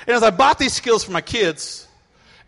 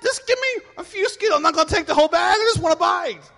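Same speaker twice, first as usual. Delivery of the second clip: pleading dad; 10 semitones higher than usual. Retold or imitated.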